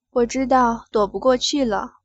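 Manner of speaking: plain reading voice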